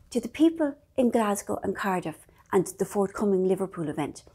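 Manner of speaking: ordinary talk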